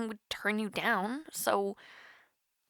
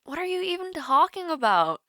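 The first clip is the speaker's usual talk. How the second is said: higher and louder, taken aback; flustered disbelief; 7 semitones higher, 5 dB louder than usual. Acted. Flustered when the friend hints she has a date, surprised. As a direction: blurt it out, caught off guard.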